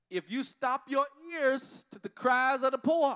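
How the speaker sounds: loud, slow pulpit cry; preaching. Acted. Pastor to congregation, preaching at full force. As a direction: call it out to the whole church.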